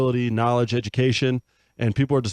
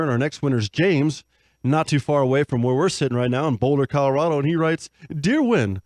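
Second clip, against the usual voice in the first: cheesy show host reading fan mail; upbeat announcer voice; 5 semitones higher than usual. Acted. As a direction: sell it like a radio show host. Host introduces next letter.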